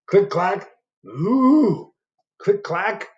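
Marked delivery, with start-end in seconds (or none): none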